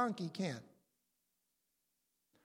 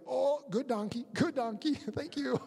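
higher, sheepish praise of the donkey; grateful Balaam; 4 semitones higher than usual. Retold or imitated. Balaam thanking the donkey after seeing the angel, sheepish and higher. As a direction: pat the donkey, a bit sheepish, half laughing. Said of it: "That's not in the text, but I'm just imagining that".

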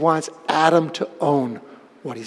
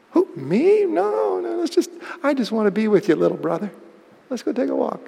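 fake innocent; 6 semitones higher, 5 dB quieter than usual. Cain coaxing his brother sweetly.

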